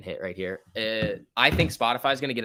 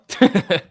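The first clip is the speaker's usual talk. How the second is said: sudden loud burst of laughter; 9 semitones higher, 9 dB louder than usual. Real, not acted. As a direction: break into a big laugh. Laughs after telling Carlo off.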